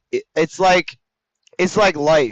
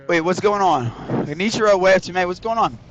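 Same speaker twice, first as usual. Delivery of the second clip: ordinary talk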